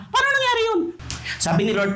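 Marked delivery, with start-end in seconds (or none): 0.1-0.8 incredulous. Real, not acted. Incredulous question.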